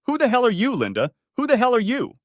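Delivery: high, loud furious demand; angry outburst. A narrator's reading. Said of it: "to emphasize his anger".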